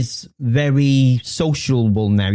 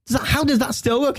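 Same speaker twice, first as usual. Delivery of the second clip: amazed; 12 semitones higher than usual. Retold or imitated. Waiter asking a kid about his old Game Boy, amazed and high. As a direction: marvel at the old console, pitched way up.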